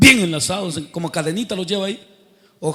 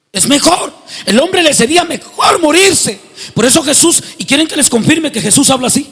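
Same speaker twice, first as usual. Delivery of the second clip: fiery preaching; 7 semitones higher, 8 dB louder than usual. Acted. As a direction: shout the warning to the congregation. Preaching at full force. (predicación encendida y a gritos)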